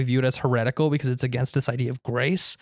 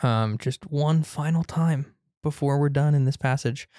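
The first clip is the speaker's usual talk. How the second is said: ordinary talk, nothing marked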